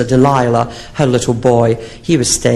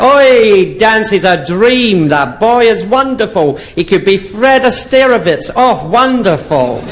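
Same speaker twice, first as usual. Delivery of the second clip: high, drawn-out gushing; gushing old lady; 8 semitones higher, slower than usual. Retold or imitated. Miriam swooning to her friend, lightly voiced high gush. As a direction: swoon over him slowly.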